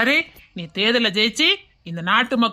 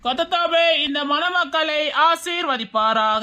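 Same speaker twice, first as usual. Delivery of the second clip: slow, raised, drawn-out ceremonial prayer; higher, slow church officiant's invocation; solemn blessing; 4 semitones higher, slower than usual. Acted. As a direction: intone a wedding blessing, stretching the words. Officiant blesses the couple at wedding.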